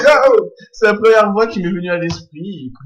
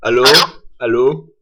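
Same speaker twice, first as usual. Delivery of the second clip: deep tough-guy voice; 8 semitones lower, 4 dB louder than usual. Pretend. Prank call in deep voice.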